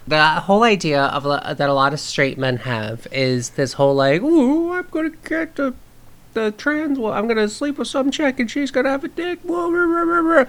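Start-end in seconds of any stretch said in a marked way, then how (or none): 4.2-10.4 put-on high-pitched goofy man-child voice; Adam Sandler voice. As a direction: put on a silly high voice, ending in babble. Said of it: "you did your Adam Sandler voice".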